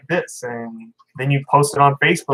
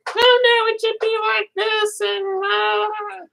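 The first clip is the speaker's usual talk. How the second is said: much higher falsetto mockery of a know-it-all; mocking voice; much slower than usual. Mocking Facebook know-it-alls, high falsetto mock voice. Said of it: "why is the mocking voice a female?".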